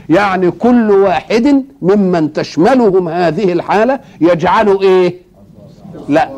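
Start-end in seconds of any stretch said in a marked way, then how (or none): none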